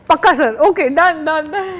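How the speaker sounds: loud, eager rapid-fire assurance; overconfident mock agreement. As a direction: agree emphatically, overdoing the confidence. Acting out her overconfident yes. (అతి నమ్మకంతో గట్టిగా ఒప్పుకోవడం)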